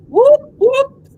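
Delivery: high, loud whoop; calling out. Retold or imitated. Calling over to her homeboy, loud high whoop. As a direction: holler across the room to your friend.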